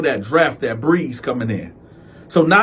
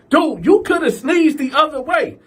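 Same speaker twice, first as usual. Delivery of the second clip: higher, exasperated; 11 semitones higher than usual. Retold or imitated. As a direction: whine it up high. Cal complaining.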